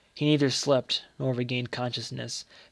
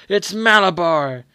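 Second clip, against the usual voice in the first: loud high frenzied scream; 5 semitones higher, 11 dB louder, slower than usual. Acted. Boy on the rocking horse screams to his mother, frenzied. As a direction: shout it out like a possessed child. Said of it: "he screamed in a powerful, strange voice".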